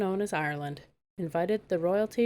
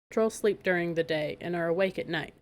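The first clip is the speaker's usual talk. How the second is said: plain reading voice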